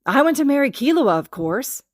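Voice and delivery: high, loud proud announcement; loud declaration. A narrator's reading. Gon announcing to Master Wing he'll marry Killua, loud and proud. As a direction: chest out, declare it to the room. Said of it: "says loudly".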